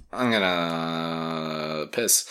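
slow, low, lazy mumble; Boone's line. Retold or imitated. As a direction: drag it out like a bored grunt. Boone voiced in recap.

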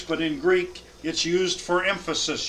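usual speaking voice

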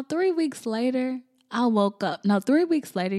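usual speaking voice

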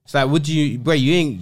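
usual speaking voice

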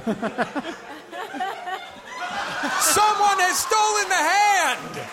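shouted from across the room, high; far from mic. Shown as a demonstration. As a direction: call it out from a distance, away from the mic. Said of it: "I'm gonna have to step away from the microphone to demonstrate this".

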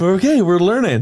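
laughing. Real, not acted. Joking after euro figure.

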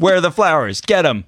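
bossy commander. Pretend. Bossy wedding planner.

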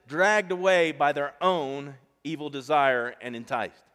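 "dragged" hit hard, slow and loud; slow emphatic reading. Shown as a demonstration. Preacher reads scripture to the church, slow and loud. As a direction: read it slowly, leaning on "dragged". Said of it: "watch this".

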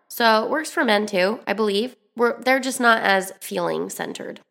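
normal talking voice

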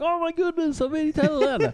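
a starstruck fan who can't believe it. Retold or imitated. Sister imagined meeting her idol on FaceTime, starstruck high gushing. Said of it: "and she'd be like".

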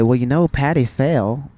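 matter-of-fact Southern mom impression; Southern accent. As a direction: say it plainly with a Southern twang. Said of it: "saying this in a Southern accent".

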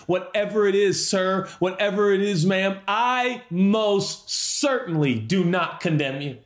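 preacher emphatically echoing Jesus to every listener; emphatic pardon. Acted. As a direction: declare it with full conviction, as Jesus did. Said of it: "I just want to say emphatically".

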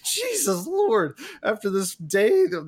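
high, drawn-out exclamation; retold exasperation. Passenger as the rail explodes again, retold exasperated cry.

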